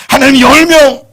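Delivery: very loud and high; final bold bid. Acted. Abraham asking God for ten, loud and high. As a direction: throw out the last number boldly.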